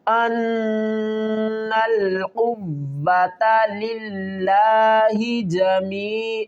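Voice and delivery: slow, measured tajweed recitation; Quran recitation. Shown as a demonstration. Teacher reciting the verse slowly for students. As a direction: chant each word with care for the students.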